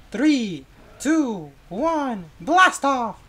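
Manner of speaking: excited countdown. Acted. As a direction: build up to blast off. Rocket countdown.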